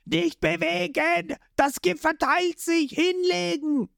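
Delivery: Patty yelling. Acted. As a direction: stop him moving, alarmed. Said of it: "wird von Patty angeschrien".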